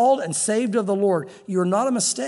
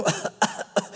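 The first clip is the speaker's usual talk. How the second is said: string of dry, voiceless coughs; put-on coughing fit; imitated coughing; 4 dB quieter than usual. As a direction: cough as if choking on dust. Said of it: "coughing as all the chaff's falling".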